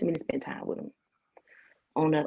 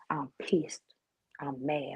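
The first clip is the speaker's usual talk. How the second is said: whispered anger; 3 semitones lower, 8 dB quieter, slower than usual. Real, not acted. Angry after long walk.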